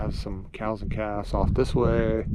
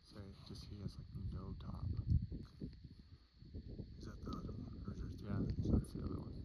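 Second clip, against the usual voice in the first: hushed whisper; 19 dB quieter than usual. Real, not acted. Hunters closing quietly on caribou.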